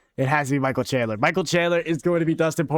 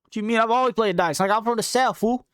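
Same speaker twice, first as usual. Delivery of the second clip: imitating a laid-back Southern guy, pitched higher and louder; 10 semitones higher, 4 dB louder than usual. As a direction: toss it off like it's obvious, fool. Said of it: "he's just like".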